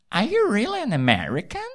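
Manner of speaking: put-on a high, soft, doubtful American girl voice; suspicious American girl. American girl's suspicious question.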